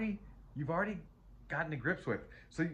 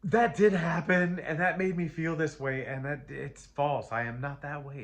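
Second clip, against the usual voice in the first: heartfelt declaration; 5 semitones higher, 8 dB louder than usual. Acted. Voicing the viewer's inner vow, loud and heartfelt. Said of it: "deep into your heart and said".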